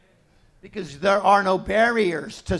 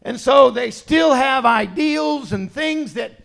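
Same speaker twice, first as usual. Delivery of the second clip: preaching shout; 4 semitones higher, 9 dB louder, slower than usual. Real, not acted. Preaching at full force.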